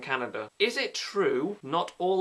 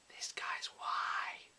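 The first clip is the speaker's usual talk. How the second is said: unvoiced, much softer, slow; hushed smug secret; slow conspiratorial whisper; whispered aside; 12 dB quieter, much slower than usual. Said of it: "whispered aside".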